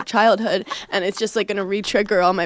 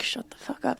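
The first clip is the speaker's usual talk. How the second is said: much softer, higher mock hush; imitated hushed reproach; 4 semitones higher, 12 dB quieter than usual. Everyone to the excited interviewer, hushed mock reproach. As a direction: mimic an urgent under-the-breath telling-off. Crowd hushing her as star waves.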